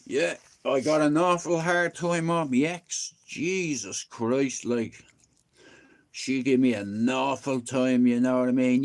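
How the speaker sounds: ordinary talk, nothing marked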